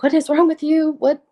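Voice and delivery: imitating mom yelling. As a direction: retell mom's shocked yell, a touch hammed. Mom alarmed at her daughter.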